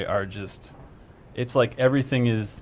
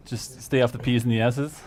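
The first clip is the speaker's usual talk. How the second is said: ordinary talk, nothing marked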